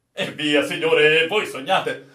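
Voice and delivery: loud, brushing-off retort; dismissive protest. Acted. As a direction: wave the idea away loudly. Sailor dismisses officer's claim.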